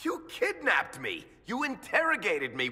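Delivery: shouted back in defiance; angry retort. Acted. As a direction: throw the grievances right back. Varric fires back in quarrel.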